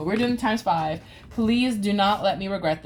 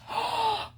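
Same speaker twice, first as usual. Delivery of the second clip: sharp gasp; 13 semitones higher, 5 dB quieter than usual. Real, not acted. Rolls a poison again.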